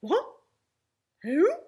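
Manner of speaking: loud, abrupt one-word questions; funny startled voice. Acted. Father shocked by the caller on the phone, funny voice. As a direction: blurt each word loudly, taken aback. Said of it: "his voice goes all funny".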